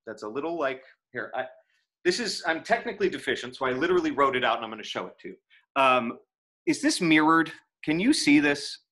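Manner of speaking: normal talking voice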